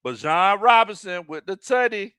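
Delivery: usual speaking voice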